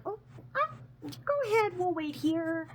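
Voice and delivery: very high, stalling voice; imitated high-pitched hesitant excuse; acted awkward demurral. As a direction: politely beg off in a high squeak. Acted awkward refusal to walk along.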